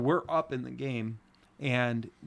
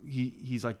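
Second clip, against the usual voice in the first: usual speaking voice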